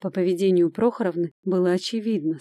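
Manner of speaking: ordinary delivery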